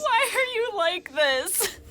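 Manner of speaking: high, slow, laughing despair; laughing exasperated whine. Real, not acted.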